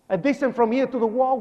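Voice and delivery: normal talking voice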